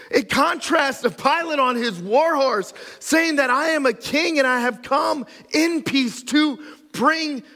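loud, high-pitched preaching; full-force preaching. Real, not acted. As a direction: preach it out with fire to the crowd.

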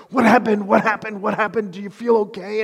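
acted-out frantic parent, pitched high; panicked freak-out. Dad running to his hurt kid, acting out panic. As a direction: fire off worried questions in mock panic. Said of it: "I'll just freak out".

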